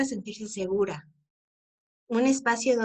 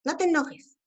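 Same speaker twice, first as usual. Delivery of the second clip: mock scolding parent, pitched higher; 5 semitones higher than usual. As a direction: imitate a parent shutting down a child's tears. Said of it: "no le digas". (imitando a un padre que regaña a su hijo, más agudo)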